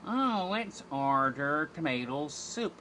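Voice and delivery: hammed-up lazy hipster voice. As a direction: draw it out like an airy hipster. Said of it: "being a hipster".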